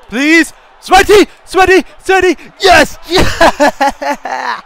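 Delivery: high, loud yelps building into laughing celebration; excited shouting. Real, not acted. Gamer urging on a sweaty goal, excited yelling. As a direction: shriek for the goal, then cheer.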